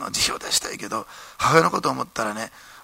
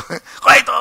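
high, loud scolding bark at Ito; boss shouting; 12 semitones higher, 11 dB louder than usual. Retold or imitated. Company president shouting at young Ito at the banquet, loud and high.

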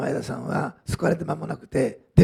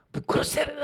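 retold yell, pitched much higher; grito imitado; 10 semitones higher than usual. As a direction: imitate someone yelling a threat, high-pitched. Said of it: "大声で叫んでですね".

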